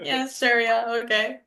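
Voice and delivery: lightly dismissive, sarcastic assent; sarcastic agreement. Acted. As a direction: go along with it unconvinced. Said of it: "That was the sarcasm in my voice".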